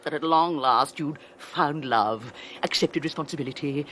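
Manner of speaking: ordinary talk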